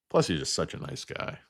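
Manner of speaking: ordinary delivery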